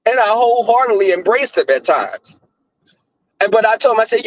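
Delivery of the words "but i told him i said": ordinary talk, nothing marked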